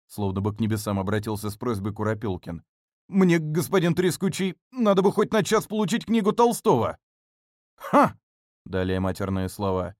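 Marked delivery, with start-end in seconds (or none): 3.1-7.0 high, pleading ask. Acted. Pleading with the butler. 7.8-8.2 loud high scornful ha. Acted. Butler's scornful laugh.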